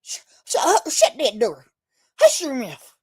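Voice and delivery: put-on a light take on an old Virginia "hush your mouth"; Virginia dialect. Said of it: "in Virginia say".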